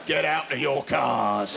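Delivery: slow, bellowed order to the waiting room; examiner's mock bark. Driving examiner ordering nervous candidates out of their cars, slow mock bark. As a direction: call them out like a drill sergeant.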